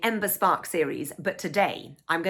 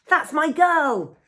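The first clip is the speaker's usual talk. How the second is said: slow, much higher, louder cheer from the audience; proud shout; 10 semitones higher, 6 dB louder, much slower than usual. Acted. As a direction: call out proud from the seats. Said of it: "shouts Dad from the audience".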